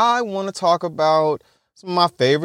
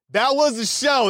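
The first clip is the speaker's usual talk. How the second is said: ordinary talk, nothing marked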